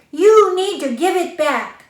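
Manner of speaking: high, loud angry demand. Acted. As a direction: demand it back, furious. Children demand summer back.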